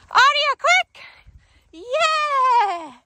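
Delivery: high, loud call into a cheer; excited recall. Real, not acted. Trainer recalls distracted dog, excited shout and cheer. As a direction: call urgently, then celebrate.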